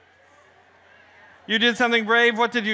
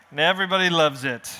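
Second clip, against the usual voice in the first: usual speaking voice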